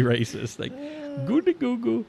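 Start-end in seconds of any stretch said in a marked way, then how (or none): 1.3-2.0 high, silly jabber sounds; high goofy mock jabber; mock Eddie Murphy gibberish. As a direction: babble it like a cartoonish Eddie Murphy bit. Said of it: "Like Eddie Murphy".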